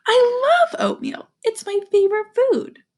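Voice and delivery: put-on high, excited girl's voice; delighted Kayla. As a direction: gush over a favourite food. Kayla delighted by the oatmeal smell.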